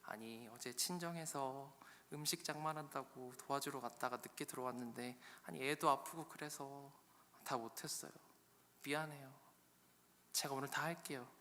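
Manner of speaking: soft, apologetic wife making excuses; quiet, subdued apology; meek Leah. Acted. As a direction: explain yourself meekly, keeping the voice down. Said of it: "레아가 이야기를 하는 거죠".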